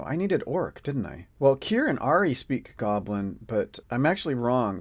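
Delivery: ordinary talk, nothing marked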